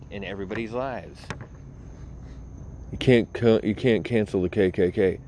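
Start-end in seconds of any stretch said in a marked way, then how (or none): 2.9-5.2 mocking whine. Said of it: "mocking critics again".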